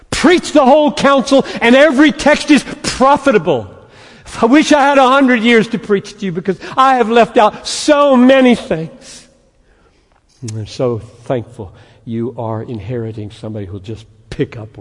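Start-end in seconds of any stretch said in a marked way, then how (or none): 0.1-9.3 preaching. Real, not acted. Preaching at full force.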